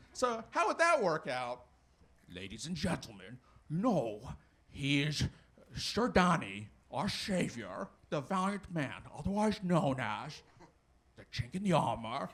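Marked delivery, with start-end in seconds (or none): 2.4-12.3 grand, halting announcer voice; hammed-up ceremonial introduction; medieval herald. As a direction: present a knight with mock pomp, pausing between phrases. Acted medieval herald introducing a knight.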